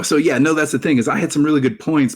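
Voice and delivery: ordinary talk, nothing marked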